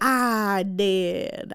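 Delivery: put-on drawn-out Southern accent; mock Southern belle confession. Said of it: "she has a southern accent now".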